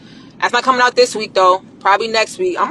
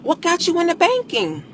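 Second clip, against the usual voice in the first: higher, sweeter what got you into banking; interested question; 9 semitones higher, 3 dB quieter than usual. Shown as a demonstration. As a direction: ask like you truly care. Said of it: "Let them talk".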